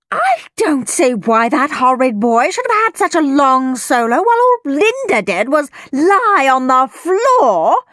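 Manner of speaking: high, indignant mother's yell. A narrator's reading. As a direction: yell a high-pitched complaint as an outraged parent. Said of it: "yelled one mother".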